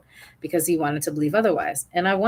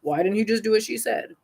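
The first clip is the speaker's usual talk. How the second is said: higher, mocking voice of judgmental critics; imagined critics' voice; 4 semitones higher, faster than usual. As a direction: rattle off the nagging questions like a scold. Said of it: "it might send off triggers like".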